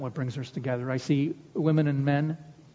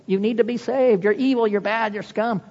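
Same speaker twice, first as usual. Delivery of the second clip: mock browbeating; 7 semitones higher, 5 dB louder than usual. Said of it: "We browbeat people".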